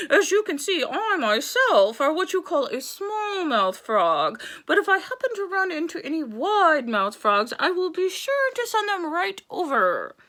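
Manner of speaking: high, loud little-mouthed voice; pursed-lip frog voice. Acted. Frog faking a small mouth to the crocodile, pursed and high. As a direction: talk through tiny pursed lips. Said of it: "pursed his lips together like a little circle".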